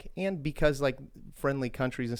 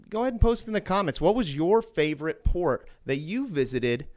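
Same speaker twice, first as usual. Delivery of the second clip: eager appeal; 6 semitones higher than usual. Real, not acted. Calling out to viewers to comment.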